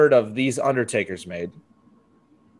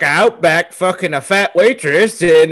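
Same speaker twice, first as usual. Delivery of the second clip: ordinary talk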